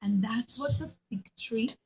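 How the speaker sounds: usual speaking voice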